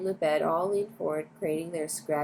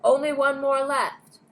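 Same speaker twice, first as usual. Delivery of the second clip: higher, louder, slow and emphatic; headline shout; 7 semitones higher, 5 dB louder, slower than usual. A narrator's reading. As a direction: trumpet the headline. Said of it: "screamed the headlines".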